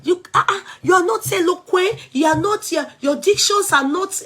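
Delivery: hammed-up sneer at bad diction; mocking critic. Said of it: "Now she say".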